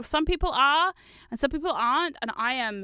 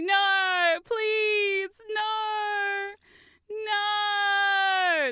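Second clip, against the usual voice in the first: higher, hammed-up begging; mock desperate plea; 8 semitones higher, much slower than usual. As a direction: plead after the runaway bat, overplayed. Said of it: "I was like".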